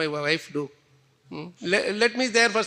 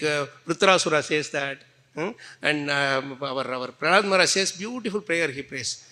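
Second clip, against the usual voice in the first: ordinary delivery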